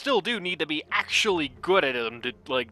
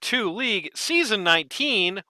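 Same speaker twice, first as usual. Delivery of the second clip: announcer's opening; 8 semitones higher, 7 dB louder than usual. Acted. Caster greets viewers at the match start, booming announcer hype. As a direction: belt out a big show-opening welcome. Opens the cast.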